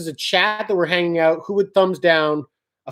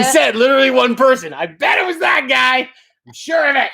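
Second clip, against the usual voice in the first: high, raised-voice accusation; pitched up and insistent; loud accusation; 6 semitones higher, 3 dB louder than usual. Real, not acted. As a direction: call out the culprit, loud and certain. Jokingly accusing the lone disliker.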